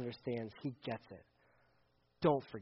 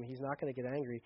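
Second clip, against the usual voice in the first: normal talking voice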